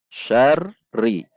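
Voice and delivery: "Syarri" seperti hendak berhenti di r lalu naik; tasydid bainiyah. Shown as a demonstration. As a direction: tahan r "Syarri" sejenak, naikkan lagi. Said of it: "seperti hendak berhenti, kemudian naik kembali". (r ganda "Syarri" turun lalu bangkit)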